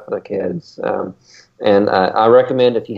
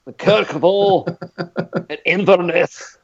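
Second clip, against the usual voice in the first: put-on British accent; 9 semitones higher, slower than usual. Listing cruise stops to cohosts in a hammed-up British accent. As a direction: place names in a high, slow, hammed-up British Isles accent. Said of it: "Helps if you do the accent".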